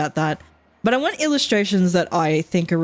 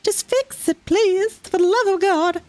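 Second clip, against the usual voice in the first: high, desperate begging, much higher; exasperated pleading; 12 semitones higher than usual. Retold or imitated. Mocking locals begging the council to fix infrastructure, high desperate plea. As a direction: beg them to just fix it, pitched way up. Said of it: "And it's like".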